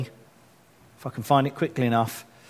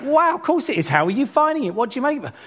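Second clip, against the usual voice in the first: excited reply; 10 semitones higher, faster than usual. Retold or imitated. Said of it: "No, I said, like".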